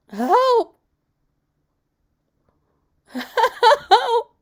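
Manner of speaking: very high, very loud cry for help; terrified scream. Acted. Boy screaming for his brother as the hand pulls him, terrified. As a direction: scream as if being dragged away. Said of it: "And he screams".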